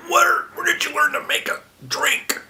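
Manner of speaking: high, loud, indignant mock scold; a scandalized elder priest sputtering after a cough; retold priest reacting to a weak drink, mock indignant; mock outraged old priest.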